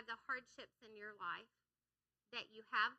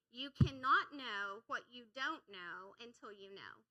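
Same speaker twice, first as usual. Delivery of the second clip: slow, louder, weighty delivery; slow repeat; 7 dB louder, slower than usual. Shown as a demonstration. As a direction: spell it out slowly and firmly. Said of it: "I'm gonna say it slow one more time".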